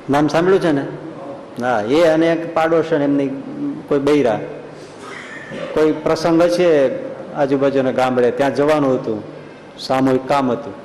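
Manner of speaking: ordinary talk, nothing marked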